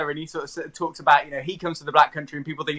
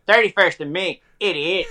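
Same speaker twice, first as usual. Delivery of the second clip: imitated Northern Irish girlfriend; retold answer in Northern Irish; Northern Irish accent; 3 dB louder than usual. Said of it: "is from Northern Ireland".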